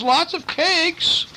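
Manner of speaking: very high, eager piglet voice. A narrator's reading. Truffles agreeing to cakes, high and eager. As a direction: squeal yes like an excited little pig. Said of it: "said Truffles".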